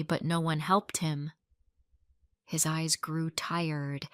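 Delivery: plain reading voice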